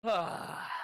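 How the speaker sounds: big, high exhaled "ah"; acted sigh of getting home at last; loud relieved sigh. Hero relaxes after climbing in.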